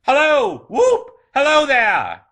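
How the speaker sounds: very high, loud whooping; gleeful full-voiced calls; jubilant shouting. A narrator's reading. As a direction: holler and whoop with abandon. Scrooge hollering in his transports.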